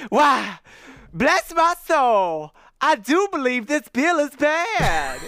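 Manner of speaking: high, loud, slow mock astonishment; theatrical Southern gentleman feigning shock; exaggerated surprise. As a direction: gasp it out in hammed-up shock, laughing through it. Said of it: "struck an attitude of exaggerated surprise".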